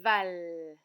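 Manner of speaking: "val" loud with a stretched opening v; isolated final syllable. Shown as a demonstration. Teacher gives children the final syllable, loud and drawn out. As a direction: hold the v, then land hard on "val". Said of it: "c'est la syllabe finale".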